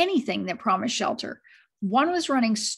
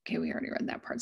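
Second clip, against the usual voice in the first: whispered aside; 9 dB quieter, much faster than usual. Real, not acted. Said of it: "unvoiced whisper".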